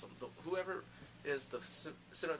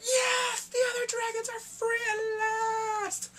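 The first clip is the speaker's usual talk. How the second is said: very high, loud, slow cry of joy; overjoyed screaming celebration; ecstatic shout; 8 dB louder, much slower than usual. Retold or imitated. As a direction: scream it like a fan losing it. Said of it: "I'm like".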